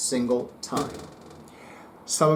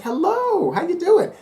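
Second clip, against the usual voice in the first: much higher, bright sing-song hello; eager greeting; 5 dB louder than usual. Retold or imitated. Psychic eagerly greets the baker arriving with her goods. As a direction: greet her like a kid spotting candy. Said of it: "I'm like".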